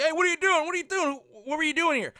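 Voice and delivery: high, loud, indignant shouting; angry yell. Retold or imitated. Son yelling after the man leaving his mom's room, angry shout. Son chasing him out angrily.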